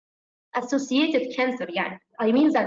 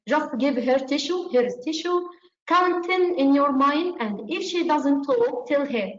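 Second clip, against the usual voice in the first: ordinary delivery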